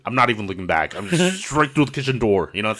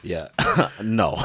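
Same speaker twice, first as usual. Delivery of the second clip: usual speaking voice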